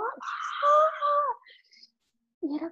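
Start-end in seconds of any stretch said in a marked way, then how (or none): 0.0-1.3 high open scream of release; mock cathartic scream; imitated scream. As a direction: let out a long high ah. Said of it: "pero gritando así como".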